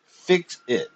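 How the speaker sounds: demanding. A narrator's reading. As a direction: insist hard. Demanding repair.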